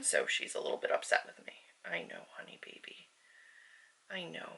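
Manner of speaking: hushed whisper to the cat; soft whisper. Real, not acted. Whispering to her upset cat on the desk, soft and hushed. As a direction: whisper gently so the cat stays calm.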